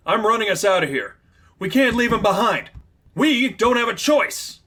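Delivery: high, loud, urgent; urgent escape. Acted. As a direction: hurry your partner away. Slipstream fleeing in urgency.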